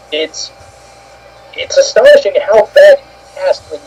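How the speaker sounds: normal talking voice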